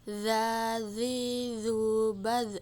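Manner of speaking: "Za, zi, zu, baz" with voiced th as in "this"; long "Za, zi, zu", voice flowing without breath; soft flowing dhal. Shown as a demonstration. Teacher shows dhal, "Za, zi, zu, baz" as soft voiced th. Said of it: "Suara mengalir dengan lembut".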